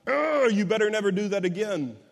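mock angry growl of a fed-up parent; imitated burst of exasperation; exasperated scolding. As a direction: act out a cross, warning telling-off. Acting out wrong, angry correction.

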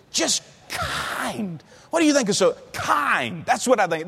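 mocking critic voice. Preacher mocking critics who call people too nice, in a high sneering voice.

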